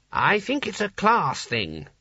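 high, slow, timid offering; meek explanation. A narrator's reading. Small wizard explaining ape and monkey to Sconner, meekly. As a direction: venture it meekly, stretching the words. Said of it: "said the wizard meekly".

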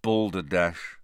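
low, dull reply; dully. A narrator's reading. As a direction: give the word with no enthusiasm. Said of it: "said Harry dully".

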